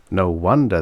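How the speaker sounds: ordinary delivery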